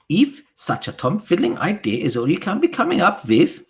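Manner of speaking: loud, high scornful witch voice; Grand High Witch thundering her contempt. Acted. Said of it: "thundered the Grand High Witch".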